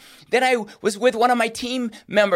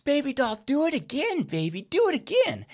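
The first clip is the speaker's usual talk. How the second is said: put-on higher, eager coaxing of a baby; encouraging toddler; 4 semitones higher, 4 dB quieter than usual. Dad urging his baby daughter to step again, high coaxing. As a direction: cheer her on to try again.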